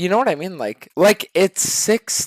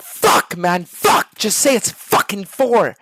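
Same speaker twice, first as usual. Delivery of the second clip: exasperated plea; 4 semitones higher, 4 dB louder, slower than usual. Acted. Streamer begs teammate for answer.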